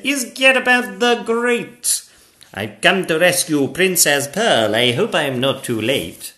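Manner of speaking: high, loud gallant knight; Gadabout the Great. Acted. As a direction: proclaim yourself a rescuing knight. Knight introduces himself.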